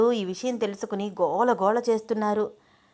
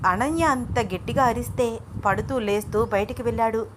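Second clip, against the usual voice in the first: ordinary delivery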